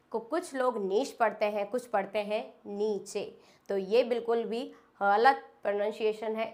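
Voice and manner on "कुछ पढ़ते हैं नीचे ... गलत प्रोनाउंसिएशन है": ordinary talk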